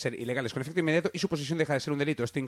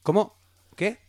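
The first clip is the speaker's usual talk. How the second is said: incredulous guard; 4 semitones higher, 6 dB louder than usual. Retold or imitated. Guard shocked by walkie reply.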